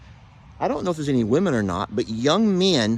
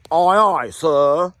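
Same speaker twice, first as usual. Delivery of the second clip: louder, much higher eager soldier; 7 semitones higher, 4 dB louder than usual. Acted. Vlogger voicing a soldier answering his captain, higher. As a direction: salute the captain with your voice.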